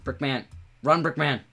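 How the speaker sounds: loud urgent yell to run; shouting. Real, not acted. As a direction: scream it, desperate. Urging a character to flee.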